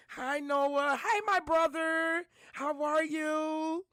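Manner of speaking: overjoyed hello to her son, drawn out; excited greeting. Real, not acted. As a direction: call out a joyful hello.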